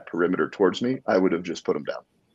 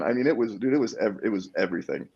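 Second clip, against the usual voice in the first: normal talking voice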